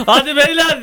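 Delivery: high, loud laughing call of "hadi beyler"; mock cheer. Hosts squeezed on a balcony, egging each other on with a laughing shout. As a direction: shout it through a laugh like a pushing crowd.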